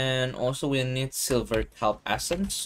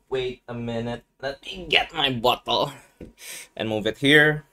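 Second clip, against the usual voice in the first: ordinary talk, nothing marked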